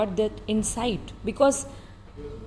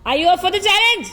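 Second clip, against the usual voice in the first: higher, louder and faster hype question; hype-man energy; 6 semitones higher, 4 dB louder, faster than usual. Shown as a demonstration. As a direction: pump him up like a game show host. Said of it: "So slow".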